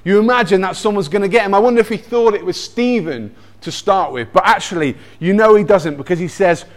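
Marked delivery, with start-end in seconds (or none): none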